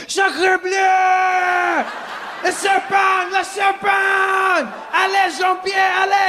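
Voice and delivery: put-on high panicked French accent shout; french chef. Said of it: "The French guy".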